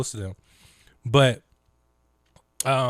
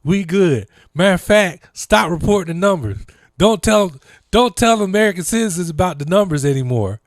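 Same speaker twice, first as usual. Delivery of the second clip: mocking Trump administration; 6 semitones higher, 6 dB louder than usual. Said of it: "they was like".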